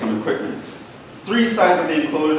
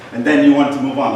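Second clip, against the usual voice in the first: ordinary delivery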